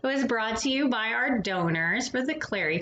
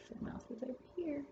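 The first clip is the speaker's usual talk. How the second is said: gentle bedtime picture-book read-aloud; storybook reading. Acted. Reading a winter picture book to children, gentle storytelling.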